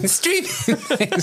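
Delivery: high, slow silly voice through a laugh. Pretend. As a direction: drag it out high while cracking up. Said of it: "Do the voice".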